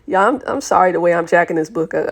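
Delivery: usual speaking voice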